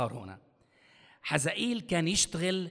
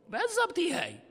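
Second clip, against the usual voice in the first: excited approval; 14 semitones higher than usual. Real, not acted. Affirming a congregant's answer.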